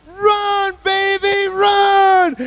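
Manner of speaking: high, full-out drawn-out scream; acted mother's urgent high yell; mom cheering on. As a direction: scream encouragement across a field.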